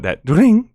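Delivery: high sung ringing tone; imitated ring sound. Imitating game sound effect.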